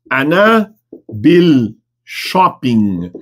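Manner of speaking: "Ana... bil... shopping", very slow and separated; word-by-word demo. Shown as a demonstration. Teacher models a new Arabic answer for students, slowly word by word.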